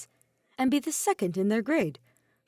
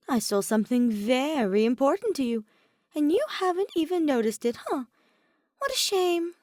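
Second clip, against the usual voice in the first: tsking tease; 6 semitones higher, 3 dB louder than usual. Acted. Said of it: "he tisked".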